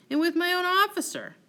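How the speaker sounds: high, loud outburst of jealousy; outraged. Acted. Wronged husband rages to his confidant about the officer, outraged. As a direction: cry out in wounded disbelief.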